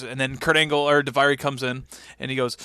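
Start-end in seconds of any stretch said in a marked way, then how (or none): none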